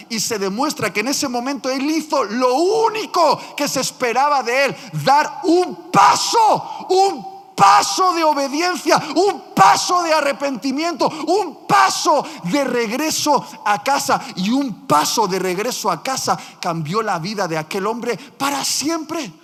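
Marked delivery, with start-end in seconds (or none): 5.0-14.2 high, loud, slow shouted preaching; preaching at full force. Acted. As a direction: hammer each step home to the congregation. Predica a pleno pulmón. (predicación a gritos, aguda y lenta)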